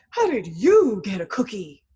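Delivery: loud, outraged pigeon voice; put-on pigeon protest, loud; indignant pigeon. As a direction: demand it as a jealous pigeon, swooping on the words. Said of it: "then the pigeon is like".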